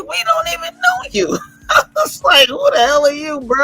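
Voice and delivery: hammed-up high-pitched "who are you?"; squeaky mock disbelief. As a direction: shriek it like you can't believe the nerve. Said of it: "We was like".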